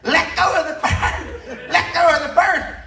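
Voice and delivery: high, slow, shouted warning from the attendant; attendant yelling. Retold or imitated. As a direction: bark it up high like a panicked zoo worker. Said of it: "the attendant's yelling".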